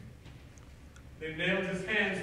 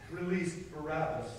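ordinary talk

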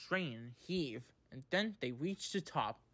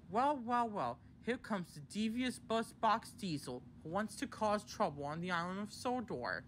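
higher-pitched scowling taunt; scowling Percy; 7 semitones higher than usual. A narrator's reading. Percy scowls at the newcomer on the island, scowling. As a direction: sneer it in a raised voice. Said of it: "scowled Percy".

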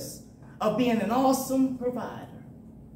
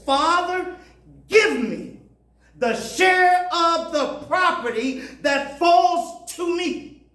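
higher, sing-song voice of a demanding son; 7 semitones higher, 4 dB louder than usual. Acted. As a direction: plead like a spoiled son. Son demands his inheritance.

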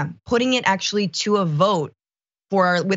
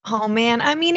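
usual speaking voice

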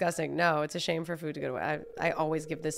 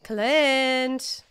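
raised, drawn-out call; 5 semitones higher, 5 dB louder than usual. Pretend. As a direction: call a character's name, stretching it. Calling out to character Clint.

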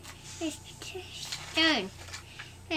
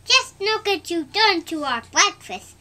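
loud, higher scolding; indignant complaint; 6 semitones higher, 7 dB louder than usual. Acted. Family scolds Thomas over breakfast, indignant. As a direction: scold the engine. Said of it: "John's family said".

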